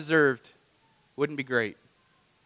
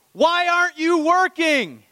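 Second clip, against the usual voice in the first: higher, louder and slower, pressing the question; preached challenge; 13 semitones higher, 5 dB louder than usual. Acted. Preacher turning the landowner's question on the congregation, louder and higher. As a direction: lean on it like a preacher calling people out. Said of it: "let me say it to you today".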